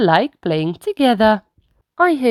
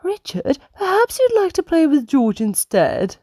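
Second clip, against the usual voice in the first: higher, coaxing mother voice; gentle Mummy Rabbit; 10 semitones higher than usual. Acted. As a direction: coax her little son kindly. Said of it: "says Mummy Rabbit".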